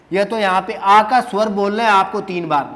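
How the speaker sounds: ordinary delivery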